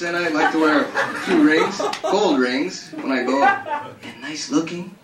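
high, excited mock Ronnie, laughing; eager Ronnie. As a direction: play the flattered dupe. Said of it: "this is Ronnie".

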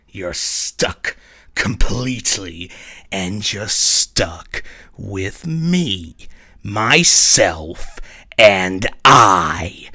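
mounting taunt. Acted. Mirror self berating Mars, sneer swelling to loud jeers. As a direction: build from a sneer to a loud jeer. Reflection berates him, building.

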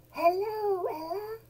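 put-on high excited child greeting; delighted greeting. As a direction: squeal hello to a friend. Maisie greets Ella.

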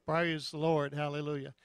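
usual speaking voice

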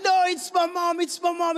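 insistent protest. Retold or imitated. Lost boy arguing with the guard over his mother, insistent protest.

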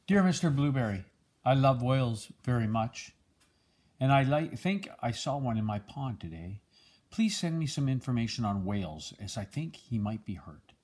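usual speaking voice

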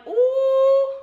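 loud, high "ooh"; excited high squeal of "ooh"; high whoop. Real, not acted. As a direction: let out a high, playful whoop. Whoop before laughing.

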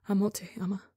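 broken, breathless whisper; devastated farewell whisper; destroyed whisper. A narrator's reading. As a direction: whisper it as if you're falling apart. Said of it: "how destroyed Tubbo sounds".

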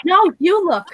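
high, forceful comeback; angry retort. Acted. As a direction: cut them off sharply. Girl cuts off drunk boyfriend.

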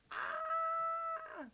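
high, sustained squeal; excited squeal. Real, not acted. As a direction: let out a long, very high squeal of anticipation. Excited reaction as trailer starts.

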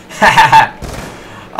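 loud burst of laughter; short amused cackle; mocking laugh. Real, not acted. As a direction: laugh out loud at a silly sight. Laughs at a limping zombie.

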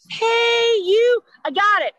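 mock the cheery nurse calling out; chirpy nurse. Mom mocking a perky nurse greeting. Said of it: "not like".

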